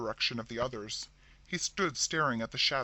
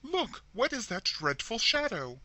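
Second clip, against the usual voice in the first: frightened; 8 semitones higher, 3 dB louder than usual. A narrator's reading. Terrified sister urging her.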